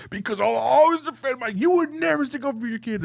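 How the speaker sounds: hammed-up heated yell; heated argument. Husband retells shouting at his wife about their kids.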